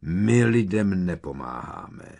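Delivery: slow, loud growl of refusal; mildly menacing, drawn-out snarl. A narrator's reading. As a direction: growl it out slowly and firmly. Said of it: "zavrčel kentaur".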